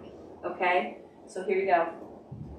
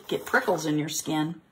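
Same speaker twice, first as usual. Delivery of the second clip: ordinary delivery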